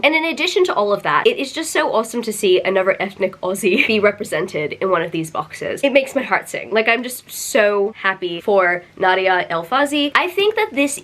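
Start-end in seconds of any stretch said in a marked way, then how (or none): none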